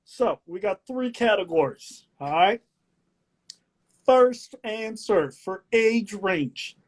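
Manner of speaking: drawn-out, showy quiz announcer; game-show host. Acted. Host lays out age-range categories, game-show delivery.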